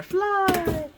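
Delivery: high coaxing call; will the bubble to fly; urging cheer. Real, not acted. Crafter urging her bubble to fly.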